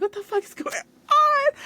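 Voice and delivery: high, loud laughing wail; laugh-crying shriek. Retold or imitated. Best friend bawling quote.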